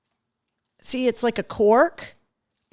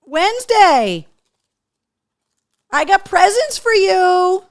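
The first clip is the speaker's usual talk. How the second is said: excited call; 9 semitones higher, 8 dB louder, slower than usual. Real, not acted. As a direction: call out louder and higher, drawn out. Calling the other dog excitedly.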